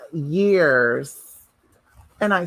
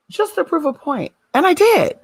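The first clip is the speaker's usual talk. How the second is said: triumphant; 8 semitones higher than usual. Real, not acted. Triumphant rise.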